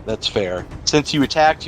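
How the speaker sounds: ordinary talk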